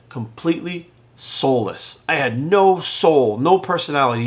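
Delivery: ordinary delivery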